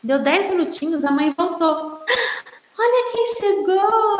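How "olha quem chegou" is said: put-on high, bright sing-song hello to a toddler; cheerful greeting. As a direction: announce mum's return with delight. Caregiver greets child as mother returns. (alegre e agudo, como quem anima uma criança)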